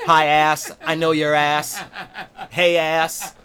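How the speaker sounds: loud, punched-out mock hellos; mocking greeting. Comedian as Wanda telling Larry off, loud mocking greetings. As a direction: throw each greeting back at him, loudly.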